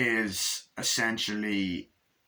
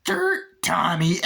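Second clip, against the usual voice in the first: high, loud gloating shout, breaking into laughter; Chucky; 8 semitones higher, 7 dB louder, much slower than usual. Retold or imitated. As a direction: yell it as a cackling killer doll. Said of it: "he starts laughing, going".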